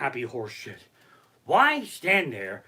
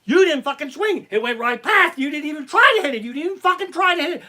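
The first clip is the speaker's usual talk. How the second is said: high, loud taunting scold; mock umpire taunt; 9 semitones higher, 8 dB louder than usual. Umpire taunting the batter who didn't swing, loud high jeering. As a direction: jeer at the batter who never swung. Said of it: "the fucking umpire's like".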